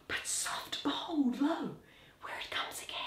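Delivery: whisper, the ghost is back; hushed alarm, don't let it hear; urgent whisper. Acted. Horatio warns the others the ghost returns, whispering.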